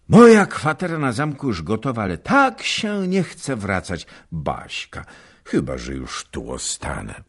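fond husband. A narrator's reading. Husband speaking fondly to wife.

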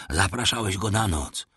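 ordinary delivery

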